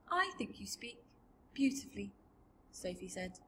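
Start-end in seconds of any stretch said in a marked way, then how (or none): 0.1-2.1 put-on soft, higher little-girl voice; quiet, kindly compliment; tender reassurance. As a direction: comfort gently and sincerely. Sophie gently comforts the BFG.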